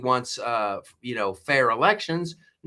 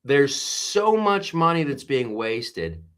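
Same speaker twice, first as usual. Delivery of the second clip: ordinary talk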